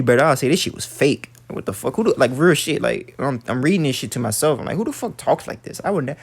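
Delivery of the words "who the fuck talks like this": higher-pitched incredulous question, softer; baffled disbelief. Retold or imitated. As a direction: quote your own bewildered reaction, pitched way up. Quotes his own baffled reaction.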